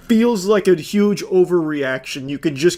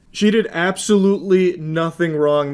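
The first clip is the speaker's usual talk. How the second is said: ordinary delivery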